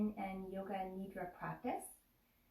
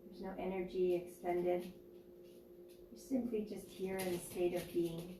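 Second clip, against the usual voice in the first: guided meditation; 3 dB quieter than usual. Acted. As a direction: ease them into stillness, unhurried.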